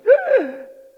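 a sharp, high gasp of horror; shocked gasp. Retold or imitated. Wife gasps at soy sauce poured on rice. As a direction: gasp like you've seen a crime. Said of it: "My wife goes".

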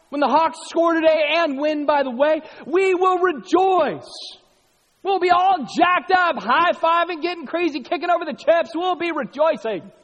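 high, loud, fired-up; excited preaching. Real, not acted. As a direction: whip up the room about the game. Excited sports celebration picture.

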